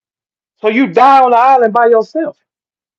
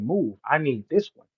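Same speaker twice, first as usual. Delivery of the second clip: low, soft and hushed, like a private word to a teammate; quiet quoted line; 6 semitones lower, 11 dB quieter than usual. Retold or imitated. Quoting Jay Mills telling him, low and hushed. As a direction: murmur it as if passing on a secret. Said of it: "That's why Jay Mills said".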